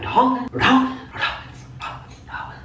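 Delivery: high disbelief fading into a whisper; dumbfounded. Retold or imitated. Retold shock, fading away to whisper.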